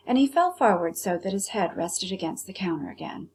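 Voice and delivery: ordinary delivery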